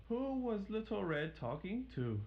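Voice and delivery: curious doctor. Acted. Doctor voiced in story.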